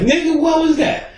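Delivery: high, startled mock shriek. Mocking his startled wife: a high shocked cry.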